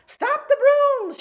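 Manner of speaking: very high, loud, slow shout. Acted. Manager shouting at runaway brooms, high shout. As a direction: yell to stop the chaos. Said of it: "shouted the manager".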